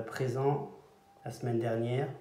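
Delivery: ordinary talk, nothing marked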